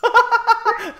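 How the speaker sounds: high, loud cry for help; panicked shout. Real, not acted. Attacked by a skeleton, calling to friend in a panicked shout. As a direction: yell for help while laughing.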